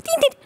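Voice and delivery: high ringing doorbell sound; doorbell ding. Pretend. Doctor mimics a doorbell for the clitoris. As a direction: ring it like a doorbell.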